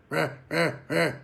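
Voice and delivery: short breathy huh huh bursts; buzz like an alarm clock. Shown as a demonstration. Goose-calling instructor demoing the alarm-clock buzz, breathy huffs. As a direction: mimic a goose buzz call. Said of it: "If you're a spec hunter, you've heard it".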